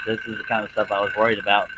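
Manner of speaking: usual speaking voice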